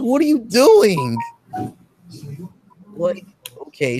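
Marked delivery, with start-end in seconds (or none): 0.0-1.2 high, slow, mock alarmed plea; alarmed question. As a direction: drag it out like a startled woman. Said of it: "it's like".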